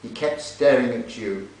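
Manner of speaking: literary reading. Acted. Reading a novel passage to an audience, lifted narration. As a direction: read the passage aloud with feeling. Reads novel excerpt aloud.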